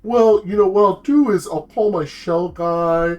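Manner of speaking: scamming turtle. Retold or imitated. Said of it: "And then he's like".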